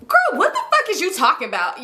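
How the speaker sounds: high, exasperated mock outburst; irritated. Answering the whispering auntie on the phone, irritated. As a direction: burst out high, incredulous and irritated. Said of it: "Y'all, I'm irritated".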